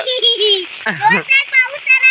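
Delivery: put-on high prompting laugh for the child; laugh to show him. Shown as a demonstration. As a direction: laugh out so he copies it. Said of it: "हस इकडं". (मुलाला हसवण्यासाठी उंच आवाजात हसणे)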